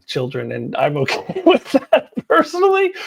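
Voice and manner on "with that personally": laughing. Real, not acted. Amused aside about adult fans.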